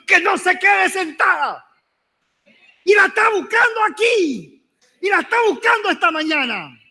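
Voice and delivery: rising to a high shout; preaching shout. Real, not acted. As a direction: proclaim it at full force to the church. Climax of sermon, shouting. (gritando con fervor de predicador)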